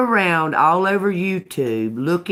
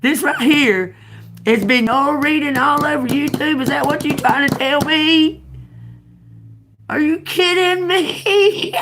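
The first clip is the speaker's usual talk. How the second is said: high, loud, laughing disbelief; incredulous outburst; 11 semitones higher, 6 dB louder than usual. Real, not acted. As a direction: shout it, half laughing. Outraged disbelief at a viewer.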